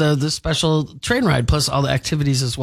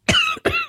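hiccup. Real, not acted. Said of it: "I got the hiccups".

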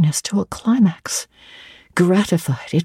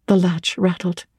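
usual speaking voice

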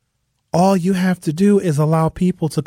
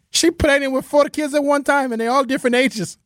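mock Greek-accented broken English, higher pitched; Giannis impression; 10 semitones higher than usual. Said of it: "Giannis is not an American".

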